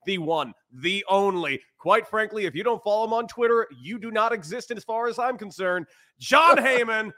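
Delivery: big hype announcer build-up; ringmaster. Acted. Grand announcer-style guest introduction.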